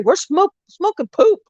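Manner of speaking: high, squeaky mock reply; husband's joke answer. Husband imagining his answer to the cop, high squeaky punchline. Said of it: "he's gonna say".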